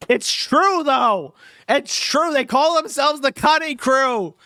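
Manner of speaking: high, loud mock-hysterical insistence; hammed-up ranting. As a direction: shout it up high like a frantic crank. Hammed-up protest at viewer's jibe.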